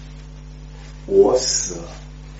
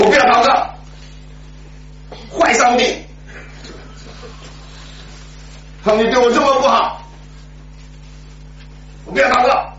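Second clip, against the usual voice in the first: angry refusal; 13 semitones higher than usual. Retold or imitated. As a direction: shout back like a hurt kid. Boy refuses to pray.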